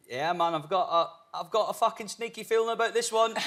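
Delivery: high, bold mate's voice; imitating a cheeky friend's confident line. Imitating his friend texting before the release, high mock voice. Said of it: "He's just".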